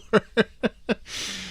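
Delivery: a real laugh, pitched high and soft; a soft, high chuckle. Real, not acted. Laughing at own joke.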